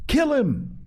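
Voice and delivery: lost in the hunt's frenzy; frenzied cry. A narrator's reading. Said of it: "carried away by a sudden thick excitement".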